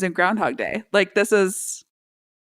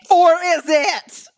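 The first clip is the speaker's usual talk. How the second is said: slow, higher and louder or is it; ominous echo; 8 semitones higher, 5 dB louder, slower than usual. Pretend. Echoing the co-host's teaser, hammed-up and ominous. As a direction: ham up the cliffhanger. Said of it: "echoes dramatically".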